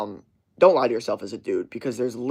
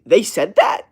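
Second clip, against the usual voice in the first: loud, high incredulous question; acted disbelief; 6 semitones higher, 8 dB louder, slower than usual. Listener checking a secondhand story, acted disbelief. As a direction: ask it as if astounded.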